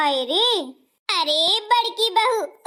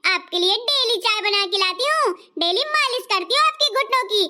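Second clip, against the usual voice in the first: sulky elder bahu; 2 semitones higher than usual. Acted. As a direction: complain like a pampered bahu. Plays the lazy elder bahu.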